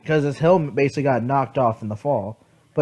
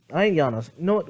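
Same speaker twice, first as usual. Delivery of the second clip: ordinary talk, nothing marked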